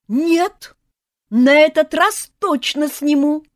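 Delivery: high, loud, slow refusal; defiant shout. A narrator's reading. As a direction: stubbornly yell him down. Son defies aunts.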